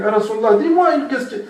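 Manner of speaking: amused retelling of an insistent man; laughing mock plea. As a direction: play the nagging questioner, half laughing. Man pestering the Prophet, retold.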